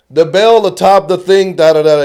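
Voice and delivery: loud, high, pompous stage line; hammed-up stage delivery. Actor declaiming obscure lines to his scene partner, hammed up. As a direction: boom it like a grand old actor. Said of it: "It's like".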